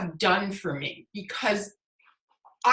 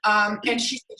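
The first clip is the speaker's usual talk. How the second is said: ordinary delivery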